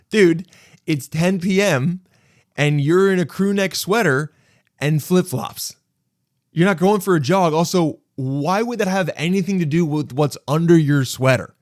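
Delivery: high, loud incredulous comeback; emphatic, disbelieving retort. Retold or imitated. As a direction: pick apart a lame excuse, loudly. Mock-arguing with the jogging excuse.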